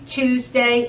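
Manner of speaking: plain reading voice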